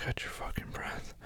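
barely voiced, very soft command; hushed and breathy; whispered order. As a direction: whisper it on an exhale.

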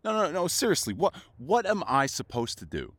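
ordinary talk

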